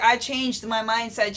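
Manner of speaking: normal talking voice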